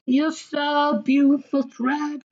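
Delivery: high, slowed sweet talk; mock lover's coo. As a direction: keep cooing up high, teasing.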